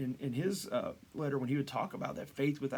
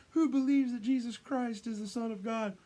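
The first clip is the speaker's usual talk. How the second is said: high, mock crowd-rousing call; rallying call; 9 semitones higher, 4 dB louder than usual. Leader asking believers about Christ, high acted rallying call. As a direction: play the showman polling a crowd.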